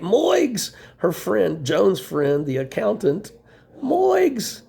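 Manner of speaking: amazed reveal of the name; surprised exclaim. Real, not acted.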